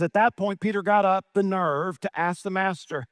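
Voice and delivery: ordinary talk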